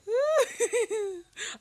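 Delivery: high bright burst of laughter; delighted laugh. Real, not acted. Delighted by Sherlock.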